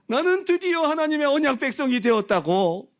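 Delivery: high, loud elated shout; mock triumphant cry. Preacher mimics cult graduates celebrating, overjoyed. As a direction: proclaim it like a joyful graduate.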